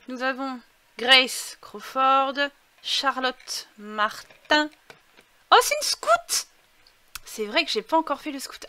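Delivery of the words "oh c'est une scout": very high surprised squeal; delighted squeal. Real, not acted. Découvre une scout. (cri aigu de surprise ravie)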